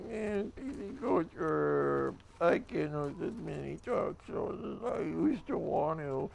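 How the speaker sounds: slow, put-on complaining guy who sounds stupid.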